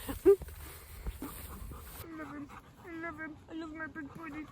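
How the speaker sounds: soft, high cooing. Real, not acted. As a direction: coo softly at the dog. Cooing lovingly at the dog.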